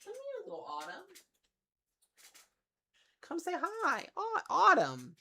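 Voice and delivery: high-pitched cat-calling voice; pet talk. Real, not acted. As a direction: coax the kitten over in a high voice. Coaxing the cat over.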